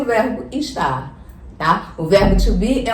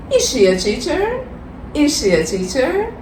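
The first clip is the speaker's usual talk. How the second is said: high-pitched question climbing on "teacher"; rising question intonation; 6 semitones higher than usual. Shown as a demonstration. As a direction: lift your pitch up through "teacher". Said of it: "Você sobe um pouquinho na sua entonação".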